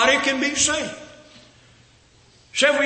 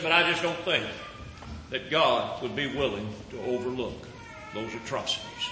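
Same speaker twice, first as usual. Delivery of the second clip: quiet confession; 9 semitones lower, 8 dB quieter than usual. Retold or imitated. Sam confessing his war killings.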